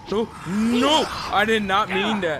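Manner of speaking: loud dismayed yelling; panicking gamer. Real, not acted. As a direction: cry out in dismay. Accidental mistake in fight.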